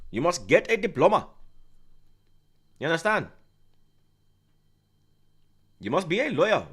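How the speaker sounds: mock Pakistani dad telling his son to study.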